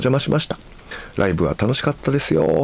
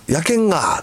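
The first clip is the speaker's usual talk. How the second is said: imitated Kumamoto speech with drawn-out ending; reply like a Kumamoto local, stretching the last vowel; lightly mimicked Kumamoto dialect; 8 semitones higher than usual. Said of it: "熊本の人はね".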